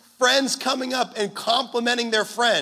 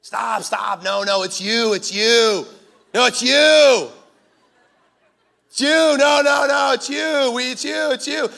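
mock flustered protest, loudest on the middle it's you; hammed-up embarrassed man brushing off praise; flustered deflecting man; 5 dB louder, slower than usual. Pastor mimicking a man who can't take a compliment, flustered.

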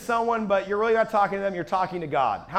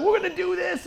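high-pitched burst of excitement; rally everyone with giddy high energy; stoked; 11 semitones higher than usual. Retold or imitated. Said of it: "You ever get, like, stoked".

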